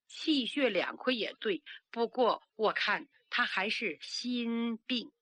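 ordinary delivery, nothing marked

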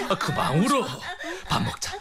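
high, sing-song coaxing with a laugh. Acted. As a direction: coax the child to stop crying. Coaxing crying child to eat.

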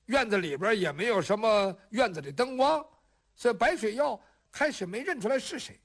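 usual speaking voice

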